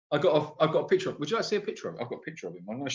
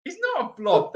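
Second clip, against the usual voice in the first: indignant protest; 14 semitones higher, faster than usual. Real, not acted. Indignant denial.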